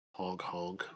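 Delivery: soft, low goose honks; badly faked goose honk. Shown as a demonstration. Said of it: "performance check to make it sound like a goose".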